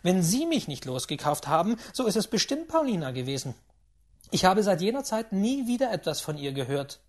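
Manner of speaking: loud, fast impatient questioning; an impatient gambler demanding news of Paulina. A narrator's reading. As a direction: push on without letting him speak. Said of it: "unterbrach ich ihn ungeduldig".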